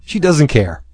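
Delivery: usual speaking voice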